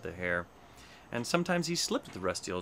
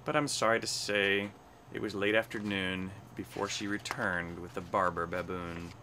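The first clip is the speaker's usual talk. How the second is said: plain reading voice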